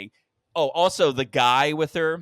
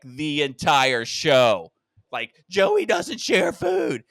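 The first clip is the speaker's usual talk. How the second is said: slow, loud, every word hit; slow pointed emphasis on "show"; emphatic; 5 dB louder, much slower than usual. Retold or imitated. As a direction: hammer "The entire show" home slowly. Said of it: "I emphatically was like".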